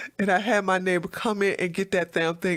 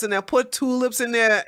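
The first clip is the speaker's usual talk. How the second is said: usual speaking voice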